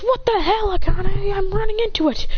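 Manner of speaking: high-pitched startled protest; alarmed protest. Acted. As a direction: protest as you're flung forward. Hero protests being thrown at monster.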